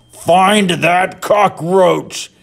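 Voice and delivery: slow, high, furious order; roaring. A narrator's reading. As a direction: roar the command slowly. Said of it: "he roared".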